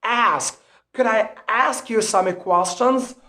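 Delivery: American front A in "ask"; American accent. Shown as a demonstration. Teacher giving learners an example question, American accent. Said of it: "difference between the American accent and the British accent".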